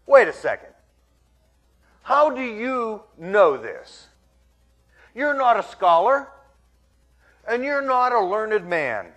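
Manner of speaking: indignant, emphatic protest; challenging crowd. Acted. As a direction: voice a doubting crowd calling out his lack of credentials. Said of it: "they're thinking to themselves".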